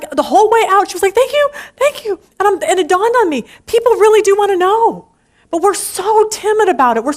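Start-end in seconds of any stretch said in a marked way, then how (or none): 1.1-2.2 higher, gushing retold delight with a gasped oh my gosh; imitating excited waitress. Waitress thanking the speaker for the pen, imitated excitement. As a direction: play the waitress thrilled at a free gift. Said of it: "She was so excited".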